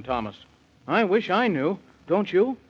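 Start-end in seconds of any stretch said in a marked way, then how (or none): none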